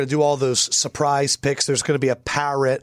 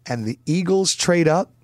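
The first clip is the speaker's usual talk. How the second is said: normal talking voice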